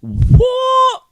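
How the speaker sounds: high squeaky shocked "what"; shocked squeal. Pretend. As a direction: squeal it in stunned disbelief. Mock outrage at show twist.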